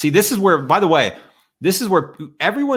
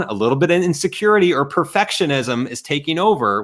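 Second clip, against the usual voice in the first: normal talking voice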